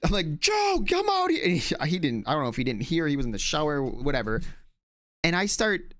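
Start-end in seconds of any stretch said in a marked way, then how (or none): 0.4-1.4 high called-out shout across the house; calling out. Retold or imitated. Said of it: "I'm yelling to Joe".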